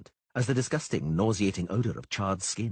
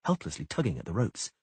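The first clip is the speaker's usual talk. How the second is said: ordinary delivery, nothing marked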